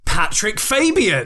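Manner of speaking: usual speaking voice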